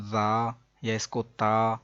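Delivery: जा and ता with an open, thin "aa"; thin mispronunciation. Shown as a demonstration. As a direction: say जा and ता light, unrounded. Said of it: "ता नहीं पढ़ेंगे".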